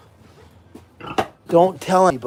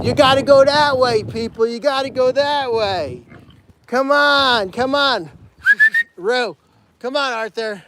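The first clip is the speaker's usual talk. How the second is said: calling out to pigs; 6 semitones higher than usual. Real, not acted. Herding pigs the wrong way.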